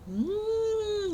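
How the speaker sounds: high, long hungry mmm; hungry wolf hum. Acted. Wolf licking his lips.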